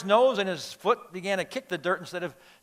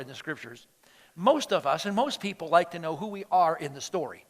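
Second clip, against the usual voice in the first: ordinary delivery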